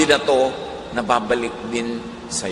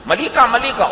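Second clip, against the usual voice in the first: high, sharp mock accusation; hammed-up angry accuser, much higher; accusing jab; 10 semitones higher, 3 dB louder than usual. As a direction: point the finger and jab. Angry person accusing you.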